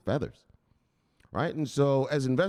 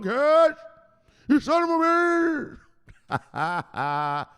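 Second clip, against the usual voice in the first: much higher, louder, slow drawn-out yell; gleeful yell; 5 dB louder, much slower than usual. Pretend. Streamer gleefully mock-shooting a follower, high loud yell. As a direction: crow it out at the top of your voice.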